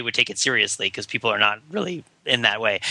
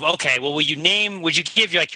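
ordinary delivery

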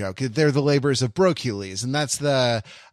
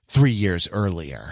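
movie title card voice; 9 semitones lower, slower than usual. Pretend.

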